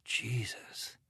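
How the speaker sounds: breathy whispered oath; whispered thought. A narrator's reading. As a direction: breathe it under your breath. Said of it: "thought Kincaid".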